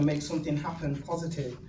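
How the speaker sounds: ordinary delivery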